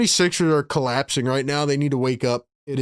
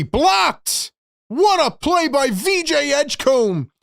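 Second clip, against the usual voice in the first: high, thrilled call of a big play; real high-pitched commentator frenzy; ecstatic play-by-play; 12 semitones higher, 4 dB louder, slower than usual. Real, not acted. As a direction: explode with excitement as the play unfolds. Live call of block and dunk.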